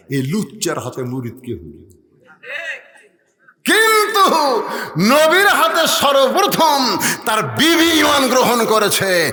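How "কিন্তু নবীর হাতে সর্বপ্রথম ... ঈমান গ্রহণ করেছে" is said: high, loud, driving sermon; full-force preaching. Acted. Preacher rejecting pir-following with a verse, full-force preaching. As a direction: thunder it out high without letting up.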